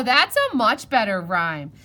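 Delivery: ordinary delivery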